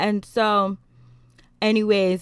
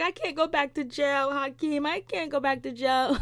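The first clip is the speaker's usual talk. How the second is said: pleading; 6 semitones higher than usual. Retold or imitated. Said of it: "she was like".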